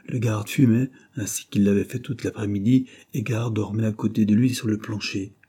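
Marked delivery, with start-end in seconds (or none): none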